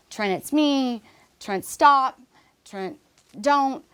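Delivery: retold desperate appeal, punching the last words; urgent plea. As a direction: plead with them to stop, in short bursts. Acts out urgent plea to shooter.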